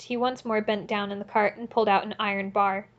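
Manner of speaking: ordinary delivery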